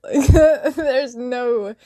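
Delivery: slow, loud burst of laughter through words; giggling giddily mid-sentence; laughing. Real, not acted. Telling Kim about the dream, giddy laughter.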